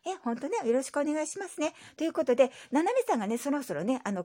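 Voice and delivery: ordinary talk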